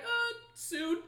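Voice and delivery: high, hesitant dodge; mock evasive answer. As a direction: stall like a guilty man. Mocking John's evasive answer.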